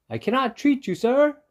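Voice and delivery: high, loud shout through the door; shouted refusal. Acted. Dentist shouting down at the fox outside, high and loud. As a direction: shout him away from the door. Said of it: "Doctor DeSoto shouted".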